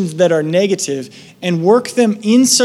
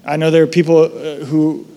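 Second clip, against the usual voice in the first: prayer; 2 semitones lower than usual. Acted. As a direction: pray over the congregation. Leads a prayer.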